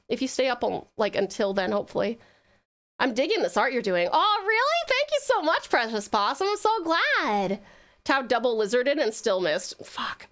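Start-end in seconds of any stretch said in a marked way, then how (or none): 4.1-7.6 high, bright thank-you gush; delighted. Real, not acted. Streamer thanking a viewer for the art compliment, delighted squeal.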